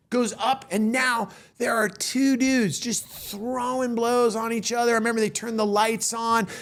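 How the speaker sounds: ordinary delivery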